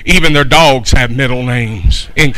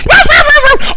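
shrill high yaps, much higher and louder; yapping poodle bark; 4 dB louder, faster than usual. Retold or imitated. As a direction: bark like an angry little poodle. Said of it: "and she's going".

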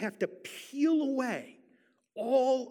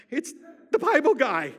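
high, loud exclamation; excited recognition; 5 semitones higher, 9 dB louder than usual. Retold or imitated. Speaker spots the Bible guy years later, excited shout. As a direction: call it out in mock astonishment. Retold shock of recognizing the trooper.

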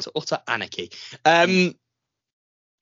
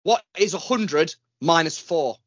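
slow, loud quizmaster question; mock quiz question; 3 semitones higher, 6 dB louder, slower than usual. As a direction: pose it like a game show host.

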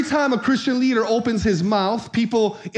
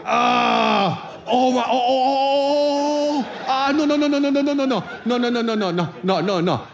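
hammed-up pained pleading climbing higher, then fading into laughter; mock pained pleading; 4 semitones higher than usual. Under the masseuse's hard press, pleading no, no, no. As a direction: beg her to stop, half laughing. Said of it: "because I got the lady that can press hard".

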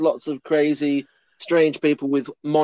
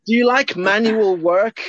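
put-on quoting the old guy; 7 semitones higher, 3 dB louder than usual. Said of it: "And then he says".

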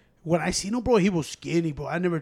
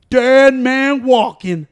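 mock prison call; 6 semitones higher, 10 dB louder, much slower than usual. Joking about dog as inmate.

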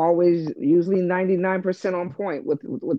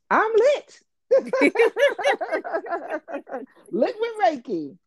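excited laugh; 14 semitones higher than usual. Real, not acted. Boasting playfully, laughing.

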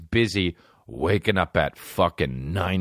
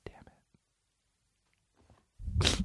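normal talking voice

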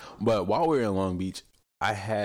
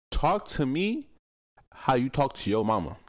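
usual speaking voice

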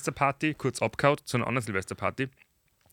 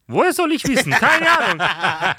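higher, louder, dragged-out shrug of a clerk; mock indifferent clerk; 12 semitones higher, 8 dB louder, slower than usual. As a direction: play the clerk who couldn't care less, stretching the words slowly. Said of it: "Ich so".

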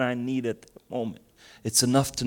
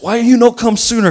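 put-on much higher and louder German-accented scolding; German doctor accent; 10 semitones higher, 12 dB louder than usual. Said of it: "old German doctor".

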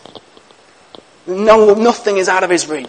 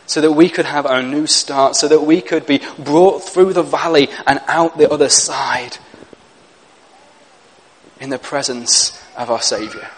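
soft devotional; 5 semitones lower, 7 dB quieter than usual. Real, not acted. Hushed retelling continues.

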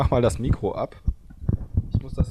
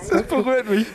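laughing mock emotion; 10 semitones higher than usual. Real, not acted. Ironisch gerührt, noch lachend.

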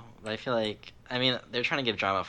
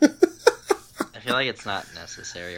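laughing; 9 dB louder, slower than usual. Real, not acted. Laughing remark.

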